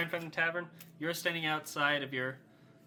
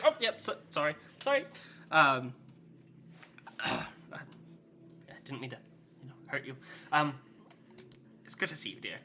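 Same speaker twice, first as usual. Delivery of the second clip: sheepish father; faster than usual. Acted. Father apologises then softens.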